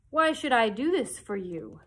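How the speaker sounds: loud, looming wizard question; booming Oz. Acted. The great Oz challenges Dorothy, loud and looming. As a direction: boom the question down at her. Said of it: "asked Oz".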